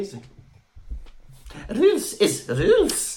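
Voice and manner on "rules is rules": high, slow mock Scottish; put-on Scottish accent. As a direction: echo it in a drawn-out Scottish voice. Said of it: "like, a Scottish accent".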